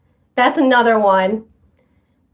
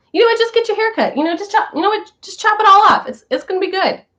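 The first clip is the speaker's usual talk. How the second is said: higher, bright push to just do it; egging a viewer on, higher and keen; eager urging; 7 semitones higher, faster than usual. Real, not acted. Urging a viewer to chop off her hair, eager and higher.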